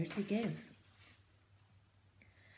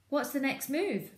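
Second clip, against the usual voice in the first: higher, louder question; Bunce asking; 7 semitones higher, 4 dB louder than usual. Acted. Bunce asking Bean what next, higher voice. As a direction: ask eagerly. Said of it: "asked Bunce".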